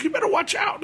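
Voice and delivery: high-pitched mock warning; retold warning. Uber driver warning the passenger about the building, mock alarmed.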